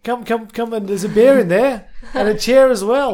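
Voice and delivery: light Maori accent impression. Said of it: "that's an awesome Maori accent".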